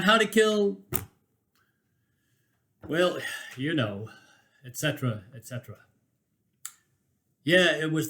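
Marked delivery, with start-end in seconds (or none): none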